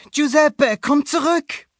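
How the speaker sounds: high, loud call; boy calling out. A narrator's reading. Luca calls his escaping goatfish back, high and loud. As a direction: shout after the fish as young Luca.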